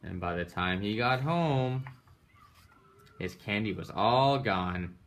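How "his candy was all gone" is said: plain reading voice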